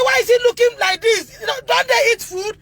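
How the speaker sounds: distressed demand. Retold or imitated. Mother confronting school, distressed.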